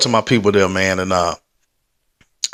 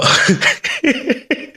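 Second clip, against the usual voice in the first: high breathy laugh; 11 semitones higher than usual. Real, not acted. Laughs mid shout-out list.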